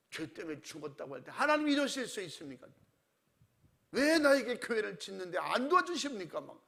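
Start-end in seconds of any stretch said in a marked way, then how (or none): 1.3-6.4 loud, indignant cry at God; angry protest to God. Retold or imitated. Despairing pastor rails at God, loud and indignant. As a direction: hurl the complaint upward, louder.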